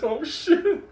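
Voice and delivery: high startled gasp; high alarmed oh shit. Retold or imitated. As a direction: blurt it in shock. Reaction on seeing dog.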